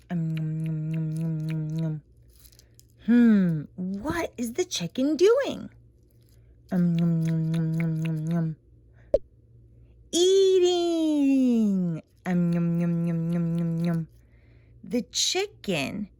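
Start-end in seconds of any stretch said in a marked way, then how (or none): 0.1-2.0 low chewing nom nom; munching nom noises. Pretend. Mom voices the chicken eating corn, low munching. 6.7-8.5 low chewing nom nom; munching nom noises. Pretend. Mom voices the chicken eating corn, low munching. 12.2-14.1 low chewing nom nom; munching nom noises. Pretend. Mom voices the chicken eating corn, low munching.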